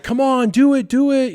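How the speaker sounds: high, loud chant of encouragement; peer-pressure goading. Acted. Older boys pressure the kid at the hilltop, high loud goading. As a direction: egg him on like a rowdy pack. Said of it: "peer pressure as you would have it".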